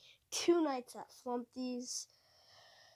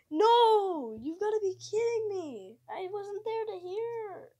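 dismayed; 7 semitones higher, 6 dB louder than usual. Real, not acted. Missed the phone call.

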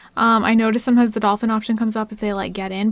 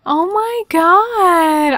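high, long drawn-out gushing; delighted awe; 8 semitones higher, 4 dB louder, much slower than usual. Real, not acted. Player spotting the boat from Castaway, high drawn-out delight. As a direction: stretch it out high in real delight.

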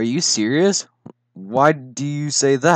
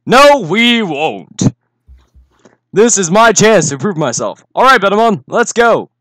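defiant hero shout; 6 semitones higher, 7 dB louder than usual. Acted. Hero defiant battle cry.

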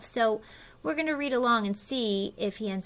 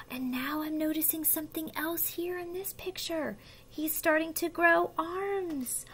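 soft, higher-pitched marvelling; hushed wonder; 6 semitones higher, 5 dB quieter than usual. Pretend. Reader shows child the growing arms, in hushed wonder. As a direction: point things out softly to a small child.

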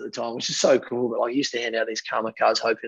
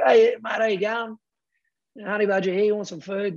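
much higher, cheery mock greeting; jolly mate impression; 9 semitones higher than usual. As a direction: imitate a mate bouncing up all jolly. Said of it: "he just walks up all jolly".

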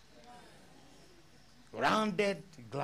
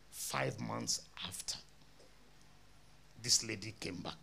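unvoiced hushed words; soft storytelling whisper; 6 semitones lower, 7 dB quieter than usual. Real, not acted. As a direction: whisper it for suspense. Storytelling hush.

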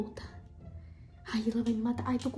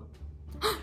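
sudden breathless gasp of surprise; sharp gasp; 9 semitones higher, 6 dB quieter than usual. Real, not acted. Gasping as the boyfriend comes online. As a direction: gasp as the message pops up.

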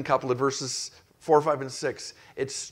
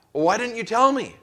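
higher, louder, accusing boss; exasperated boss; 9 semitones higher, 5 dB louder than usual. Retold or imitated. Mock boss pinning blame on his worker, higher and louder. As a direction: bark the question like a boss who won't listen. Boss blaming employee, acted exchange.

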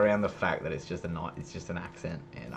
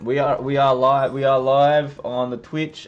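hyped announcement; 3 semitones higher, 6 dB louder, slower than usual. Real, not acted. Announcing live stream.

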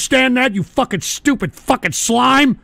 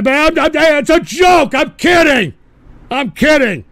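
raised, high-pitched insistence; loud protest; 5 semitones higher, 4 dB louder than usual. Real, not acted. Host insisting to chat it was a joke, loud protest. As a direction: shout it down defensively.

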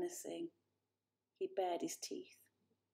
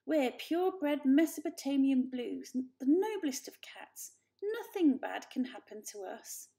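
haughty and proud; lofty, boasting cat; 5 semitones higher, 5 dB louder than usual. A narrator's reading. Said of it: "said Aunt Julie".

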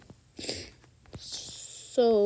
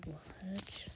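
surprised whisper; 18 dB quieter than usual. Real, not acted. Surprised at something in game.

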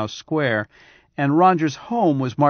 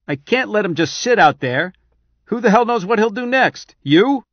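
angry; 9 semitones higher, 6 dB louder than usual. Acted. General argues angrily.

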